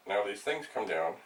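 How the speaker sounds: normal talking voice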